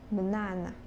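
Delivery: banana with the middle syllable stressed; banana, demonstrated with its stress on NA; stress on second syllable. Shown as a demonstration. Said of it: "the stress falls on the second syllables".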